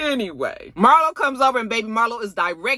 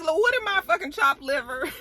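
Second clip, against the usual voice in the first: indignant Drew; 8 semitones higher, 3 dB quieter than usual. Retold or imitated. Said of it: "Drew was just like".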